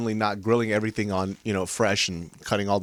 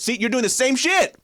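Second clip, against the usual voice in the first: excited call-out; 14 semitones higher, 5 dB louder than usual. Real, not acted. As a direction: voice jumps up, teasing accusation. Calling out friend, rising.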